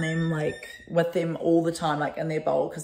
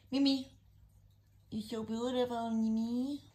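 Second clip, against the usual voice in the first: soft high coo; 5 semitones higher, 10 dB quieter than usual. Real, not acted. Owner cooing softly to her beautiful cat, tender.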